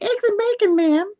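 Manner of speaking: very high, squeaky servant; cat maid voice. Retold or imitated. Tabby cat maid announcing breakfast to Madam, squeaky falsetto. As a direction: answer in a tiny falsetto maid voice.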